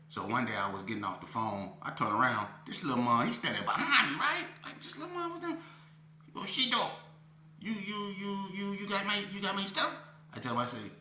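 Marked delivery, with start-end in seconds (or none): 4.6-5.6 squeaky little man. Retold or imitated. Starts voicing the little man. 6.4-10.0 put-on high squeaky stammering old man, pestering; little old man voice. Old man demanding his goods from the narrator, high squeaky nagging.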